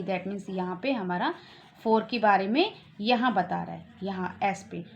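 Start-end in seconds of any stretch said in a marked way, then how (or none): none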